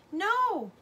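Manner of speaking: high, loud anguished wail. A narrator's reading. Mother wailing as her husband vanishes in the river. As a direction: wail it in horror. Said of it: "Ma wailed again".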